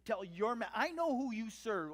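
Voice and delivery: loud, pointed accusation; loud and needling; testy. Acted. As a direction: throw it at him testily. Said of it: "it just gets a little bit more testy".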